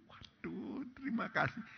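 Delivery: breathless, hushed whisper; awed whisper. Real, not acted. Preacher moved by God's word, a soft whisper. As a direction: let it slip out in awe, barely voiced.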